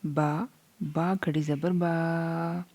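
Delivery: slow, drawn-out Qaida recitation; slow stretched recitation. Shown as a demonstration. Teacher reading slowly to students, drawn out. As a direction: stretch each syllable slowly. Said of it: "तो हम इसे पढ़ेंगे like this".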